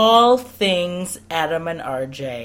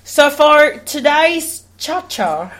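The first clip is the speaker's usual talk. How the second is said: slow, high mock Australian dancer; Australian accent; 8 semitones higher, slower than usual. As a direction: imitate an Australian TV dancer, drawn out. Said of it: "two of them are Australian".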